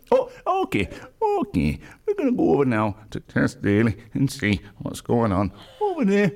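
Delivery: lightly put-on, straining presenter voice; strained character voice. Co-host straining at an impression to introduce Tess Daly, strained put-on voice. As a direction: push out a strained handover, pitch jumping about. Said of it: "You sound like a hairy biker".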